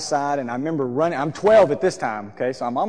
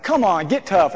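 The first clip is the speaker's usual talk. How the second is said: louder, higher, imitating a sibling's jeer; scolding brother; 5 semitones higher, 5 dB louder than usual. Brother to bawling sibling under the couch, mock scolding. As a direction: push a crybaby to toughen up.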